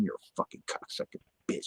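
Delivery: whispered, quick inner cursing; said in head. Retold or imitated. Explaining why the cop got pissed, whispered silent insult. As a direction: swear under the breath, as if only thinking it. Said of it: "In my head".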